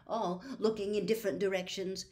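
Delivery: ordinary delivery, nothing marked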